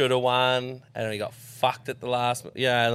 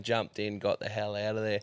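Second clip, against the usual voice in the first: usual speaking voice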